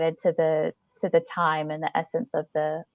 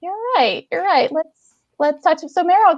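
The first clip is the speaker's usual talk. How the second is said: much higher, bright laughing reset; bubbly; 8 semitones higher, 4 dB louder than usual. Real, not acted. Host steering the chat back to the guest, bubbly and higher. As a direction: giggle back on track.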